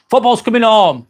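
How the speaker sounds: ordinary delivery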